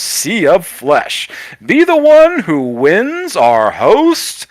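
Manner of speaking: slow, high-swooping showman delivery, stretched key words; milk every phrase like a flamboyant emcee; hammed-up announcer. Announcer inviting the crowd to the costume contest, slow and theatrical. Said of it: "As the announcer continues".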